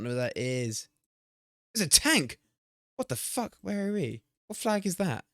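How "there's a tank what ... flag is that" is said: high-pitched, incredulous questions; baffled surprise. Real, not acted. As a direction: react in astonished confusion. Baffled by tank in the game.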